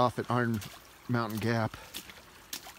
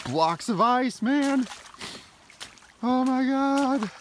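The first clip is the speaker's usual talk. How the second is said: exasperated; 12 semitones higher, 5 dB louder, much slower than usual. Real, not acted. Cold feet hiking in rain.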